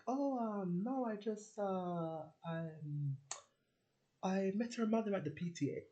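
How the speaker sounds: a hint of a flustered mum; lightly voiced mum fumbling an excuse; mum playing it down.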